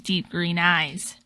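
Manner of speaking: plain reading voice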